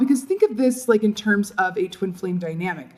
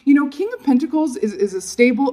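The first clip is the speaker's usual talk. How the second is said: usual speaking voice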